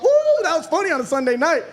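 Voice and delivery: excited ooh. Real, not acted. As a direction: crow with delight at the bit. Reacting to own joke.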